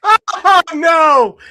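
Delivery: Oh, no! shouted high; mock shocked cry. Real, not acted. Co-host cries out at the spelling, mock horrified.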